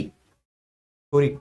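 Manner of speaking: छोरी stretched slowly, low and soft; teacher drags out छोरी so the class notes the Braj word; drawn-out word. Shown as a demonstration. Teacher to class: छोरी, slowly drawn out. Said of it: "लड़की को कहते हैं छोरी".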